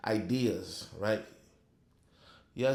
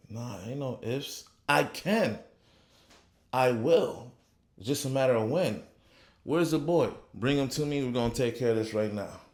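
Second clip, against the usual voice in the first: confident preaching; 3 semitones higher than usual. Acted.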